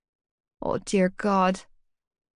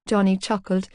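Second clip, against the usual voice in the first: ordinary delivery, nothing marked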